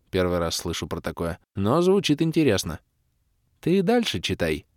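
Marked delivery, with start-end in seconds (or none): 3.6-4.7 higher, lightly voiced young woman urging him on; encouraging Kira. Kira urges the hero to keep reading, light and encouraging. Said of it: "подбодрила меня Кира".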